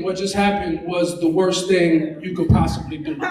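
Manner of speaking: ordinary talk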